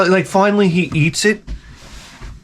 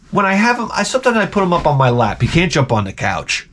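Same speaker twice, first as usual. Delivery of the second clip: normal talking voice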